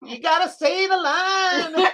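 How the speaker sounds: high, slow, crying line delivery; catchphrase voice. Retold or imitated. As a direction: ham it up like a pleading catchphrase. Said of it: "we just always, we say it all the time".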